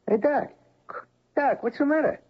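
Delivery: high, urgent calls to snap him back; rising concern, trying to get his attention; calling out. Acted. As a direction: coworker calling a dazed doctor back to the present. Trying to rouse distracted doctor.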